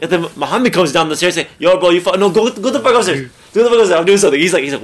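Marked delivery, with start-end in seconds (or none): 1.6-4.4 higher, louder shooing away; worked-up, high-pitched reenactment; retold irritated order. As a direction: act out annoyed shooing of an intruder. Retold order to interrupting Muhammad.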